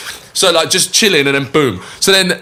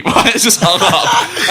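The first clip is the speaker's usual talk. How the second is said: slow, laughing disbelief; 3 semitones higher, slower than usual. Real, not acted. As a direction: let the laugh take over the line. Laughing at hacker mate's exit.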